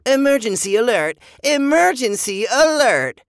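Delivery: high, loud mock robot alarm; Adam. A narrator's reading. Boy mimicking the robot's alarm, loud robot voice. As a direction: blare it like a robot siren. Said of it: "in his best Adam voice".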